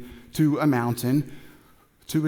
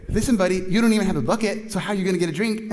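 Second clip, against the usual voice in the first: higher, louder, rapid-fire pushback; playful, raised-voice incredulous retort; sassy challenge; 6 semitones higher, 5 dB louder, faster than usual. Acted. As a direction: challenge him like he's being ridiculous. Acted challenge from the woman.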